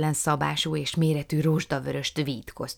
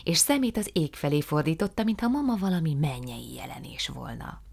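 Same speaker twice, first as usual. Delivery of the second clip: plain reading voice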